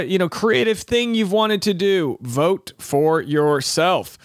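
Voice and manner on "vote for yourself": slow repeat of the moral. Shown as a demonstration. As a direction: drive the moral home slowly. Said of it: "So there's the moral of the story".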